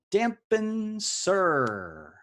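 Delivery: each syllable stretched out very slowly; very slow repeat. Acted. Quiz host repeating the clue to stalling players, very slowly. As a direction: savour the words, dragging them.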